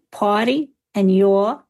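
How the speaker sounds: PARTY and YOUR without their R sounds, drawn out slowly; dropped R sounds. Shown as a demonstration. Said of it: "those lost their R sounds".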